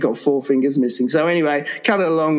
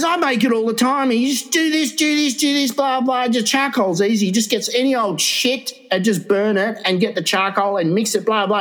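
pitched up, breezy and dismissive; high brash dismissive voice; 6 semitones higher than usual. Retold or imitated. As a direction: brush it off like it's nothing. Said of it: "And he goes".

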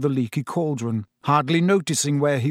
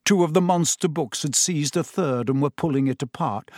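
plain reading voice

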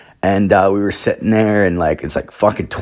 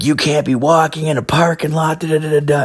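snapping at them; 9 semitones higher than usual. Retold or imitated. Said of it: "snapped out".

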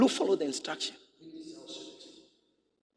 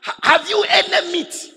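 much higher, louder, laughing through it; laughing question; 13 semitones higher, 8 dB louder than usual. Acted. Jesus teasing disciples about meat, laughing loud. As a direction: tease them with a laugh.

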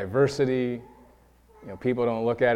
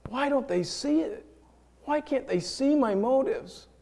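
tearful plea; 13 semitones higher than usual. Retold or imitated. Rejected helper pleading to himself, on the verge of tears. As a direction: voice breaking with hurt, slowing down. Hurt monologue turns tearful.

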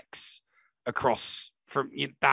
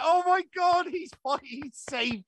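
high-pitched burst of joyful disbelief; retold overjoyed gasp; slower than usual. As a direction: relive the moment the hero saves someone. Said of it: "I was like".

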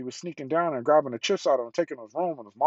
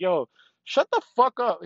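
higher-pitched mock outburst; retold annoyed outburst, pitched up high; exasperated retort; 8 semitones higher than usual. Listener telling off a sports podcast host, exasperated.

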